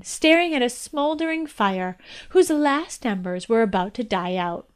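ordinary delivery

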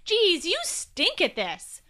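high, loud jeer; taunting shout. A narrator's reading. Will weaving between slides, shouting taunts at the nose. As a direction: shout a goading challenge, stretching it out.

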